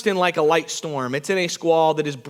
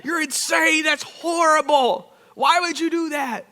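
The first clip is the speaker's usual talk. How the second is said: mock yelling; 7 semitones higher than usual. Friends to [name] after the tree, mock horrified scolding. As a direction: imitate the outraged friends telling him off. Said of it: "they all yell at me".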